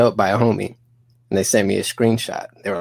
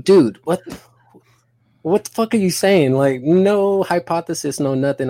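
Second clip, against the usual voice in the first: high, louder burst of disbelief, easing down; exasperated comeback; 7 semitones higher, 3 dB louder than usual. Retold or imitated. Teller replays his argument with the channel partner, exasperated.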